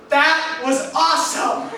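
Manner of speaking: Larry grinning after the blast, yelling with glee. Retold or imitated. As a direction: shout it thrilled and drawn out. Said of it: "he turns and he yells".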